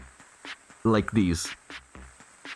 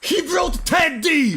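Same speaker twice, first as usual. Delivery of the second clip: broadcast his shame with glee; gleeful shout; 7 semitones higher than usual. Real, not acted. Commentator mocks the poster to viewers, gleeful shout. Reacting to the post, laughing at him.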